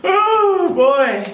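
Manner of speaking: high, loud laughing yelp; laughing squeal. Real, not acted.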